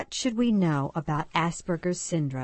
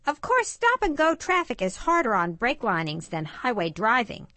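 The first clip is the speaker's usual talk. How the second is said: put-on high-pitched boy Stanley; eager car-obsessed boy; 7 semitones higher than usual. Stanley tells his dad's friend about brakes, high boyish voice.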